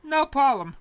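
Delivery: high loud eager reply; breezy and bright with a grin; upbeat promise, pitched high; cheery. Acted.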